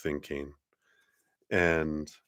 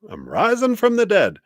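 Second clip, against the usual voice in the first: high, loud grand proclamation; triumphant resurrection; 6 dB louder than usual. Retold or imitated. Judgment card voice rising, high and loud. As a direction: announce your return from the dead. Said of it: "There's this resurrection".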